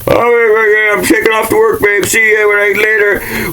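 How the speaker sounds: high cheerful mock voice of a man leaving for work; oblivious husband. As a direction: play a clueless guy heading to work.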